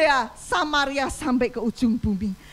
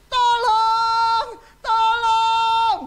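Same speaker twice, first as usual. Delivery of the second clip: desperate high cry of tolong; cry for help; 8 semitones higher, 6 dB louder, much slower than usual. Acted. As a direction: call out tolong like someone in pain. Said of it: "Tolong kalau boleh disuarakan". (jeritan minta tolong)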